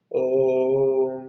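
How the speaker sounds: ওম held long and chanted; ওম drawn out like a chant; prolonged om. Shown as a demonstration. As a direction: chant ওম slowly, stretching the vowel. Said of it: "এরকম ভাবে উচ্চারণ".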